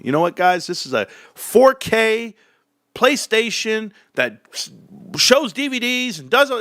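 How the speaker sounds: hammed-up bad salesman reciting features; mock clueless salesman. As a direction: drone out the specs like a clueless clerk. Mocking a clueless salesman pitch.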